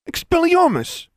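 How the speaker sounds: high, loud spell cry; shouted incantation. A narrator's reading. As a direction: shout it to disarm an attacker. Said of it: "who shouted that last incantation".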